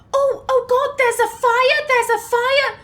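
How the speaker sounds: high-pitched, hammed-up alarm; mock panic. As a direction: play a gullible character panicking about a fire. Acting out gullible Meryl panicking at fire.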